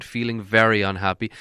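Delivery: ordinary delivery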